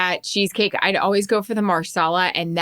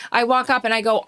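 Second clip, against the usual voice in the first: ordinary delivery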